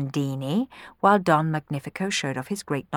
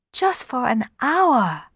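high, slow, drawn-out plea; pleading; 8 semitones higher, slower than usual. A narrator's reading. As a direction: plead for a small favour. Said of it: "Cenerentola pleaded".

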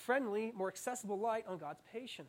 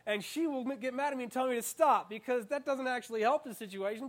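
ordinary delivery

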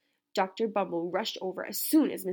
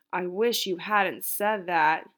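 slow, louder groaning complaint; groaned; 6 dB louder, slower than usual. A narrator's reading. Camilla to the therapist, slow groaning complaint. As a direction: groan it out, fed up. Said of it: "Camilla groaned".

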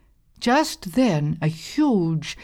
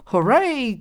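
high, loud hooray; hungry girl cheering for supper; 8 semitones higher, 4 dB louder than usual. A narrator's reading. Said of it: "cried Thorny".

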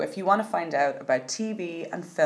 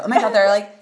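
hammed-up Valley girl gush; 7 dB louder than usual. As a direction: play up the Valley girl, oh my God. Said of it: "I really sound like a Valley girl".

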